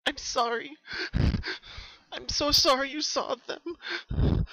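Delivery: high, slow, tearful sobbing apology. Acted. Pinky apologising to Twilight through sobs. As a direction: choke it out through tears. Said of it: "Pinky sniffed through her sobs".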